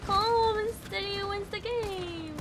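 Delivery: self-soothing mantra. Real, not acted. Player calming themself while aiming, soft mantra. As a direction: coax yourself to stay steady, softly.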